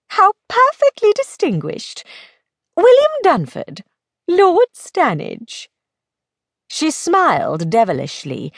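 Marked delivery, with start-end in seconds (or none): 0.1-5.7 high, mock-grand lady; teasing lady friend. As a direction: savour his new title in a high voice. Plays Belle teasing the new lord.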